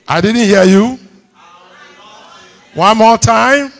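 loud, high call for a response; rousing the congregation. Real, not acted. Demands louder response from congregation.

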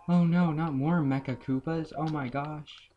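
high, loud hammed-up alarm after a gasp; gasp, then fake a panic; mock panic. Pretend panic at more enemies.

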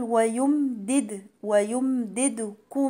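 "ويمددكم" chanted slowly with a bounced second d; qalqala daal. Shown as a demonstration. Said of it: "وفي دال مقلقلة عشان ساكنة". ("ويمددكم" بقلقلة الدال الساكنة)

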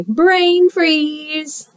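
high, drawn-out character voice; brain freeze moan.

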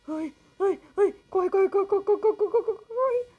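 panicked high shouts, running for it; being chased. Real, not acted. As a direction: yell in terror as a monster closes in. Monster chasing, player panics.

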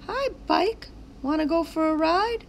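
put-on high childlike character voices; high excited kid voices in a story. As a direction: play the kids and sign kids eagerly. Sign kid voice.

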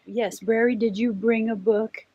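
ordinary delivery, nothing marked

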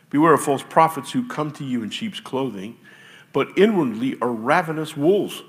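loud, declaiming scripture read. Acted. Pastor reads Scripture to the congregation with force. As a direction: proclaim the verses to the room.

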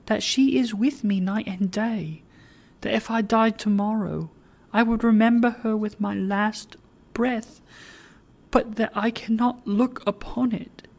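urgent tender plea. Acted. Meg's tender message for Lilian.

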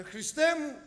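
a loud, high cry of Christ; calling out loud. Retold or imitated. Chased by a dog, he cries out to Christ, loud. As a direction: cry out for help as the dog charges. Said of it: "δυνατά".

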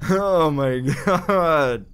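high shriek breaking into helpless laughing; shriek, then laugh through the fright; shocked laughter. Real, not acted. Shaken and laughing after jump scare.

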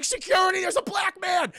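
high, loud hammed-up alarm; mock panicked shout. Exhibitor calling security on Killmonger, mock panicked shriek.

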